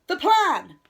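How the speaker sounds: high, loud reminder; urgent cry. A narrator's reading. Owl rallies Rabbit to the plan, urgent cry. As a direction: call it out in a rush of alarm. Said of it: "cried Owl".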